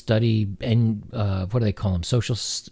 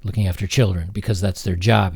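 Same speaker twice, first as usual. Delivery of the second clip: ordinary talk, nothing marked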